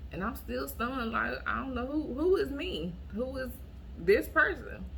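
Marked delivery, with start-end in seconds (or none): none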